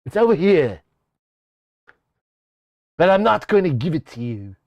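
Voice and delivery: lightly voiced cartoon child; a weak attempt at a cartoon baby villain; half-hearted character voice; Stewie impression. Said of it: "my Stewie sucks".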